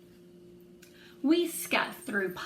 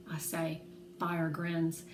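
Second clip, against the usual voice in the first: plain reading voice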